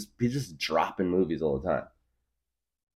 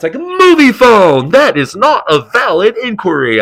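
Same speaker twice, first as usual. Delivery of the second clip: movie phone voice; 16 dB louder than usual. Retold or imitated. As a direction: boom each menu option like the phone guy. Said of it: "do the movie phone voice".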